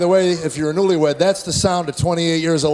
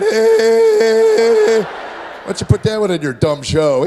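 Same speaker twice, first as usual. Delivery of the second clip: put-on high sarcastic teen; 6 dB louder, much slower than usual. Son mocks dad.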